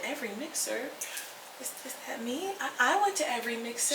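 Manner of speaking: realizing the award is about her; stunned hush. Retold or imitated. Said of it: "and I said".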